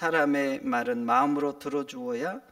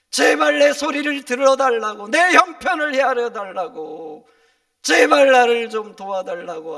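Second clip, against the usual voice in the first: loud, raised-pitch plea, imitating people begging; retold pleading cry; 6 semitones higher, 16 dB louder than usual. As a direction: cry out for help as the desperate would. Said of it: "사람들이 소리를 칩니다".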